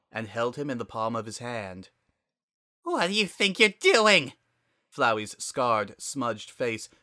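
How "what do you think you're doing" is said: high, loud furious screech; indignant at being picked up; outraged flower. Acted. Flowey panicking being scooped up.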